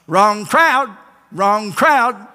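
high squawky parrot cry; parrot squawk. Acted. Preacher voicing the parrot in a joke, squawking. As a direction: squawk it like a parrot repeating itself.

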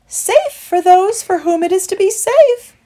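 very high, loud birdlike voice; fluting, high-pitched bird voice; cooing turtle dove. A narrator's reading. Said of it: "replied the dove".

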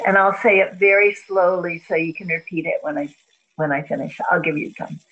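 calm meditation guide voice; guided breathing. Acted. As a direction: ease her into each breath. Leads breathing exercise.

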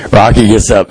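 ordinary talk, nothing marked

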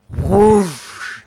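whoosh sound. Retold or imitated. Imitating fireball sound.

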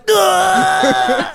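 very high, loud comic scream; hammed-up shriek of violated shock. Acts his shock at the exam.

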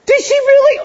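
high, squeaky scandalized whisper-gasp; a stunned, high-pitched "what the?"; shocked disciples. Retold or imitated. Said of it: "They're back there going".

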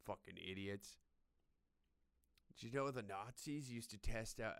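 usual speaking voice

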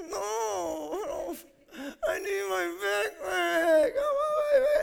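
imitating a sobbing little boy; child crying. Pastor imitating his son crying for his backpack, high and wailing. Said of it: "And he starts crying".

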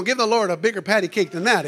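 normal talking voice

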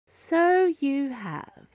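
high, slow, poised Cardinal voice; a gracious, unhurried Cardinal. A narrator's reading. Cardinal character voice.

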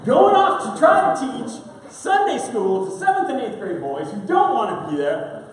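ordinary talk, nothing marked

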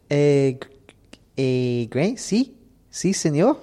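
imitated Spanish accent, rising high at the end; hesitant, questioning mock Spanish accent. Fernando approaching the host with a question, in a mock Spanish accent. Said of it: "He's like, uh".

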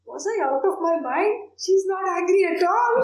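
high, lively relieved surprise; woman realizing the goddess is friendly, delighted and astonished; amazed realization. Retold or imitated. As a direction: laugh at your own foolishness. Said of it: "then she said".

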